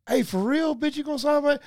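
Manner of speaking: high, pushy mock yell; demanding autograph. Fan bullying Warren Sapp for an autograph, high and pushy. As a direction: badger him like a crazed fan. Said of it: "Hey, look".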